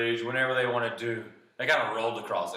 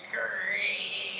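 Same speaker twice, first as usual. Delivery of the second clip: higher, drawn-out creak; creaking bridge; 10 semitones higher than usual. Acted. Bridge dipping in the middle, creaking high and long. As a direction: imitate wood slowly straining. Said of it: "the bridge was, like, going".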